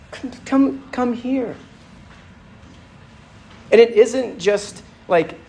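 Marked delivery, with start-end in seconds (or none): 0.1-1.6 higher, gentle beckoning; tender calling. Retold or imitated. Jesus beckoning the weary listener, high and gentle. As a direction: coax them toward you, higher and tender. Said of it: "The inclination is tenderness".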